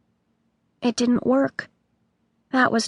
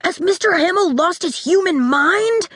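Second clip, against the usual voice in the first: put-on higher, disbelieving boy voice; startled boy; 8 semitones higher than usual. A narrator's reading. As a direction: ask in alarmed disbelief. Boy reacts to chaos.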